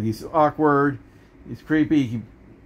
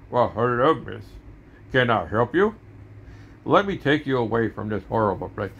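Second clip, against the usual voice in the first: smooth announcer put-on, slightly lowered; radio DJ voice; 2 semitones lower than usual. Hero offering to take a miss away, radio announcer voice. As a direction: play a suave radio announcer coming to the rescue. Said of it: "like a radio D J".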